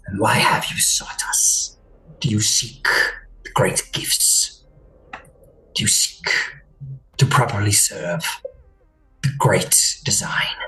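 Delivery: low, unvoiced rasp of a suspicious mind-creature; whispery alien creature. Captor confronting the Golden One, cold whisper. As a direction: hiss it out cold and accusing.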